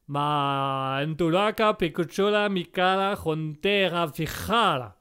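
slow gibberish in a hammed-up Italian accent; sing-song mock Italian, drawn out. Examiner rambles in hammed-up mock Italian. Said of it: "Tellement mélodieux".

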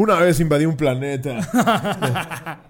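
voz puesta, lenta y burlona; Decepticon contando anécdota. Pretend. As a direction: imita a un robot villano recordando despacio. Imita anécdota de los Decepticons. (parodia lenta de villano)

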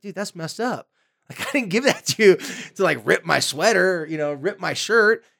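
put-on a teen calling out a friend who ruined their shirt; indignant teen. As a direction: play the wronged friend whose shirt got ripped. Teen whose lent sweater got ruined.

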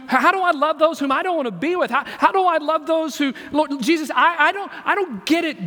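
high, loud, insistent questioning of Jesus; acted desperate plea, voice pushed up; anguished pleading. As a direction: wrestle aloud with God, stumbling and restarting. Acts out struggling believer questioning Jesus.

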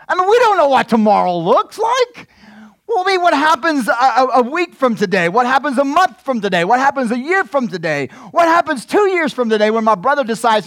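high, loud rising questions; preaching at full force. Acted. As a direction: press the worry on the listeners. Impassioned preaching.

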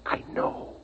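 tense whisper, barely breathed; a father's hushed reply. Acted. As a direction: whisper so the thing outside can't hear. Father hushes son near danger.

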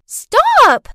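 very high, loud yell; child's shout. A narrator's reading. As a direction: shout like a little girl demanding a halt. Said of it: "Clarice shouted".

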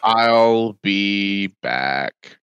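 very slow, low deadpan catchphrase; Schwarzenegger without accent. Acted. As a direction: drag out the action-hero line, low and lifeless. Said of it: "doing his lines without the accent".

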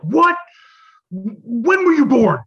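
loud, high, outraged demand; imitated stunned, angry yell. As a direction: shout it in mock alarm, stretched out. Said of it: "bulging out and screaming".

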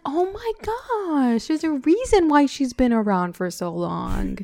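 high, slow gasp of discovery; excited realization. Retold or imitated. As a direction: squeal it as it dawns on you. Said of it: "I was like".

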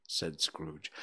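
ordinary delivery

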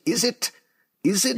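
high, sharp spat-out question; spitting Loki line. Retold or imitated. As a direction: spit it out with sharp contempt. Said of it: "he sort of spits at him and goes".

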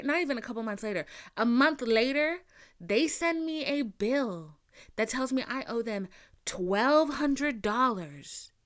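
normal talking voice

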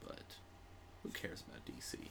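whispered dismissive aside; whispering. Real, not acted. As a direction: hush it out with no voice.

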